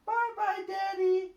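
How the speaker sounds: high, loud piglet goodbye; children crying out. A narrator's reading. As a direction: call out bye-bye like little piglets. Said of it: "cries Peppa and George".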